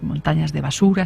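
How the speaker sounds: ordinary delivery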